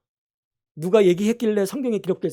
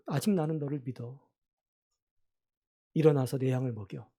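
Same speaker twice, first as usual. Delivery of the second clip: softer, gentle voice of Jesus; tender reassurance; 4 semitones lower, 7 dB quieter than usual. Acted. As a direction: quietly lift up a friend who failed. Jesus reassures failed Peter. (부드럽고 조용하게 격려하는 목소리)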